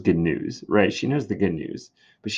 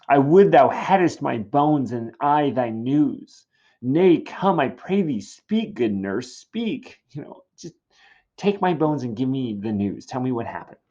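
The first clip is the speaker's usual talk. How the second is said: higher, insistent pleading; impatient Juliet; 4 semitones higher, 4 dB louder than usual. Acted. Juliet demanding news from the nurse, impatient. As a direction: urge her to speak, impatiently. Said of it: "[name] is very impatient".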